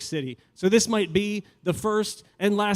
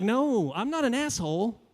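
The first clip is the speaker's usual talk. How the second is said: higher, mock-indignant protest; pleading with mock pride; 9 semitones higher than usual. He insists to the angry woman he's a feminist, mock indignant.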